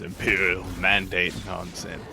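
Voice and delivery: put-on gravelly old British gent; old British man voice. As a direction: grumble like a grumpy old Englishman. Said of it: "Why is your old dude British".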